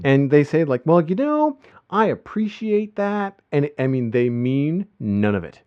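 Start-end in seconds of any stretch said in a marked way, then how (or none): 0.9-3.4 much higher, slow, sugary Texan drawl; be falsely sweet through a thick Texan accent; polite Texan drawl. Retold or imitated. The coach's wife politely hides her anger in a Texan accent. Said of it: "put on a pretty thick Texan accent for the show".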